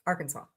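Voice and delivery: low, soft Arkansas, final S silent. Shown as a demonstration. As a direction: quietly say Arkansas ending in "saw". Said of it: "with the final S silent".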